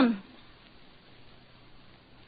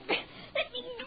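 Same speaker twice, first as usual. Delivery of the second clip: high, crying plea to be let go; frightened cry; 12 dB quieter than usual. Acted. Wife pleading with husband to release her, crying in fear. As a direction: beg through a cry, terrified.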